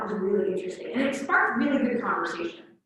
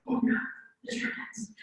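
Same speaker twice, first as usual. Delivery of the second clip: whispered, mock-terrified; mock fear; 11 semitones higher, 8 dB quieter than usual. Romans hearing the Germans are coming, mock frightened whisper. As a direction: breathe it out as if the enemy is at the gate. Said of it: "they're like, gasps".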